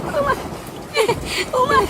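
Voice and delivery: panting, gasping "ওরে মা" between breaths; wheezing after running from the rain, smoker's lungs. Acted. Man who ran through the rain to shelter, out of breath. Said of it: "ওরে কালকে ছিল হাঁপিয়ে গেছি।".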